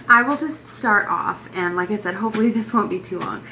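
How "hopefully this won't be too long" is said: normal talking voice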